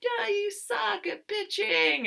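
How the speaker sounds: very high, drawn-out mock yell; retold yell back. Best friend yelling back at the pitcher, high mock holler. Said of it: "And then she's yelling".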